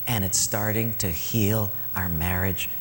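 hushed, unvoiced whisper of the wife's words. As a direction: breathe it out as a whisper. Emotional climax of story.

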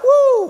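high, loud "woo"; excited whoop. Acted. Preacher showing hundred percent excitement, whooping. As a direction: whoop like a thrilled newcomer.

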